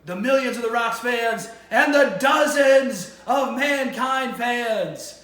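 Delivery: loud, high, drawn-out mock catchphrase; hammed-up wrestling promo parody, stretching the last words; Mankind parodying The Rock. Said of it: "Mick Foley with the Mankind mask".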